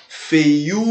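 "Feiura" with the U held very long; longest syllable stressed. Shown as a demonstration. Teacher proving which syllable is longest, "Feiura" with a drawn-out U. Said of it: "Ela é a sílaba mais longa".

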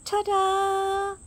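high, bright reveal; proud ta-da. A narrator's reading. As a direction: announce it with a flourish. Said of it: "said Daisy".

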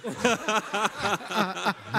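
amused laugh. Real, not acted. Laughs at the memory. (rire amusé)

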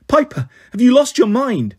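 high, loud, alarmed cry; alarmed shout. Acted. Annabeth shouts at Piper for cutting the rope, alarmed. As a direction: shout at her in disbelief.

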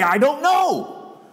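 high, very slow mocking I don't know; mock incredulous. Society mocking someone who admits ignorance, high and drawn out. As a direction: stretch I don't know as if it's absurd. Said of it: "how can you have so much info".

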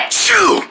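very high, exaggerated "achoo"; big pretend sneeze. End of Wrinkle Head's fit, big mock sneeze. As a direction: finish the fit with a hammed-up sneeze.

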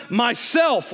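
ordinary delivery

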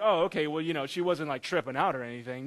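low, softening mock nonchalance; unbothered, offhand dismissal; casual underreaction. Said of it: "You're just kinda like".

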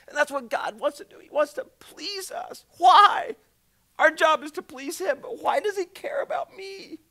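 high, loud fervent preaching. Acted. Preacher urging the congregation, rising fervor. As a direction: lift the room with urgency.

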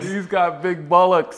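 excited joke. Real, not acted. Friend joking loudly and slowly about a rude word. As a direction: crow it out for laughs.

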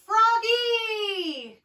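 high, drawn-out holler; mother calling loudly from afar. A narrator's reading. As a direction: sing out the name high and long. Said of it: "called his mother".

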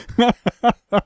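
short burst of real laughter; laughing. Real, not acted. Still amused by Roger's claim, laughter. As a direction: crack up after swearing.

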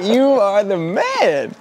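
high laugh into slow, drawn-out praise; laugh, then stretch each word high with delight. Real, not acted. Vlogger hails Harry as the man, laughing and delighted.

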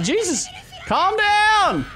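drawn-out, high-pitched exasperation; exasperated yell. Real, not acted. Player telling the game to calm down, exasperated. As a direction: stretch it out, pitch way up.

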